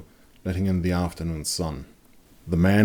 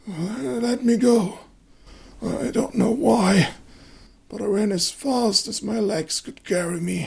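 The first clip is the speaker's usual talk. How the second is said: much higher feverish fearful voice; haunted wounded man. A narrator's reading. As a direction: remember it with dread. Wounded man recounts escape.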